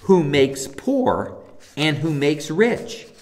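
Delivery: "who makes poor" spaced out word by word, very slow; slow emphasis. Shown as a demonstration. Preacher slowing to stress who makes poor and rich. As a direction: draw out each phrase deliberately. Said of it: "It is the Lord".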